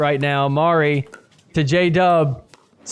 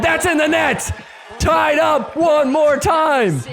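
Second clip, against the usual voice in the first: excited shout; 8 semitones higher than usual. Real, not acted. Excited call of tied point.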